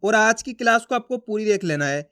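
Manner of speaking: ordinary talk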